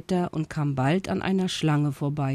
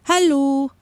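high-pitched friendly call; 9 semitones higher, 4 dB louder than usual. A narrator's reading. Leo calls to the monkeys in the treetops, calling out. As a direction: call up to someone out of reach. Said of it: "rief Leo".

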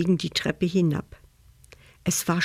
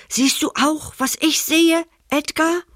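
higher, disbelieving question; stunned question; 10 semitones higher than usual. Acted. Thief asking his partner about the empty chest, high stunned. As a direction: voice jumps up in shock. Robbers open the treasure chest.

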